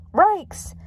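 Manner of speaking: very high cartoon dog yelp; startled dog. Scooby leaping into Shaggy's arms, high startled yelp. Scooby is tossed the cursed medallion.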